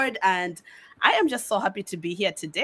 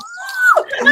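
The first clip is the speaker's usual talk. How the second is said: sharp, high gasp of delight; surprised gasp; 6 dB louder than usual. Real, not acted. Guest gasping on hearing the hosts will visit California. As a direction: gasp as if the news just landed.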